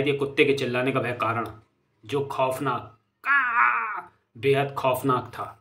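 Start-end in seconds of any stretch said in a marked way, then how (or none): 3.2-4.1 long, high-pitched crow caw. Acted. Narrator punctuating the frightful sight with a high crow caw. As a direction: let out one high imitated caw. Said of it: "कौवे की आवाज़ निकल गई".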